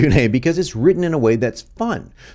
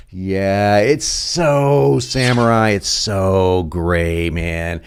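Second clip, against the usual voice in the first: very slow, stretched-out vowels; drawn-out relish; 3 semitones lower, much slower than usual. Real, not acted. Presenter admiring his own samurai question, drawn-out relish. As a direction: savor it, dragging out each key word.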